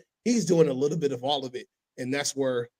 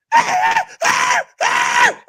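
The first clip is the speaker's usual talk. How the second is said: loud laughing burst; 10 dB louder than usual. Real, not acted. Laughing at the dog memory.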